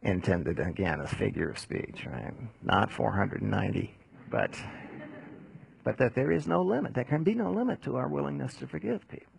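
ordinary delivery

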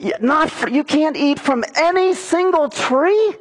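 high, disbelieving "are you kidding me"; mock Satan scoffing in disbelief; incredulous surprise. Satan mocking God's word to Eve, high and incredulous. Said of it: "it has a tone of surprise".

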